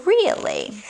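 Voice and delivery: usual speaking voice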